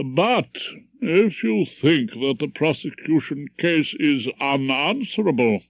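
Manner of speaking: put-on pompous old judge addressing the jury; stern judge. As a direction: lecture the jury from the bench.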